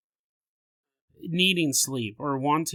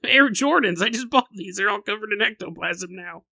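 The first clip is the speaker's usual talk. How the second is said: higher, louder mock grumble about ruined sneakers; mock annoyed; 5 semitones higher, 4 dB louder than usual. Stepping through the ghost and ruining new Air Jordans, mock annoyed. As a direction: fuss over your shoes, not the ghost.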